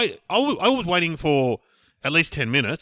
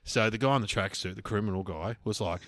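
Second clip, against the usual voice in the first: ordinary talk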